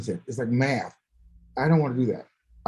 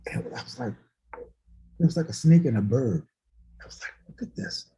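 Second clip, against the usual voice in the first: whispered, awed hush; hushed awe; 4 semitones lower than usual. Retold or imitated. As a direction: breathe it in amazement.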